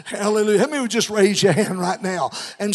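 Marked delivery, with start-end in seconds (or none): none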